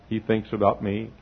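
ordinary talk, nothing marked

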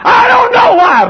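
very high, slow, loud protest; acted exasperated shout; exasperated outburst. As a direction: cry out as a cornered naysayer. Doubter cornered in retold argument.

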